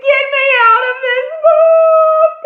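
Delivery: very high, loud shriek; terrified scream. Acted. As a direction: scream in panic to escape. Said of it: "and I screamed".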